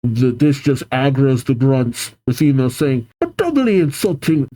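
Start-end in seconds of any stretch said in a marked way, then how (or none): 3.2-4.5 put-on grunt's line slipping into a Scottish accent; a Scottish lilt on a doubly insulting, pitched up. Female grunt retorting to Casey, mistakenly in a Scottish accent. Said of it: "nope, not Scottish".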